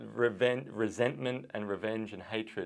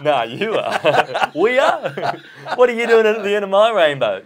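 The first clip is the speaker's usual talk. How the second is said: high, loud laughing through words; laughing hysterically at the joke; real laughter; 10 semitones higher, 15 dB louder than usual. Real, not acted. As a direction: crack up mid-sentence. Giggling fit.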